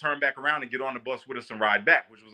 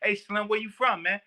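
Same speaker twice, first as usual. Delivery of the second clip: a light D C street voice, pitched up; D C slang voice; 6 semitones higher than usual. Retold or imitated. Imitating a D C inmate greeting him on the bus, D C slang voice. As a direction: greet him the way a D C cat would. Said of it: "I can talk like a D C cat".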